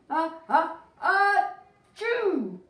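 loud, high hammed-up sneeze building to chew; big sneeze. Acting out the elephant's huge sneeze for a child, loud. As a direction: build the sneeze then burst.

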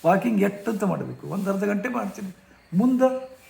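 imitating a frustrated old man; exasperated retort. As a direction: push back, tired of advice. Rich man retorting to guruji.

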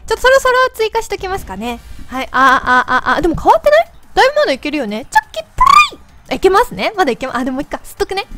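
high helium squeak. Real, not acted. (ヘリウムガスの高い声)

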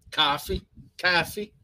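rising New York "Coffee" with an open "cawfee" vowel; New York accent. Shown as a demonstration. Trying out the viewer's request, light New York coffee. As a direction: toss off "Coffee" like a New Yorker. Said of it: "Say coffee and a bagel repeatedly".

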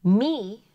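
Me called out loud and clear; sounded-out word. Shown as a demonstration. Teacher calling out the word me. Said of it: "the two sounds I hear are M".

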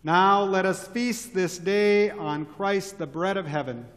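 normal talking voice

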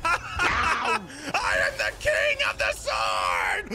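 drawn-out victory shout; triumphant shout. Real, not acted. Winner of the sword duel to his rival, triumphant shout. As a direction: crow it out like a new champion. Crows over winning the duel.

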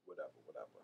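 breathy unvoiced brushing off; dismissive whisper; whispered dismissal. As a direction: wave it off under your breath.

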